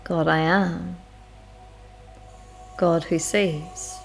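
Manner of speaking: ordinary delivery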